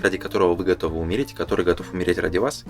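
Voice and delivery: ordinary talk, nothing marked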